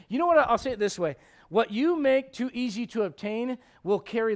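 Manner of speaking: high, loud, driving pulpit voice; forceful preaching. Real, not acted. Preacher admonishing young people about pursuit, high and forceful.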